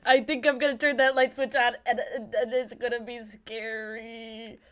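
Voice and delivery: loud, high, wobbling fake wail; mock terror. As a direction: wail it like a hammed-up scaredy-cat. Pretends terror of the dark house.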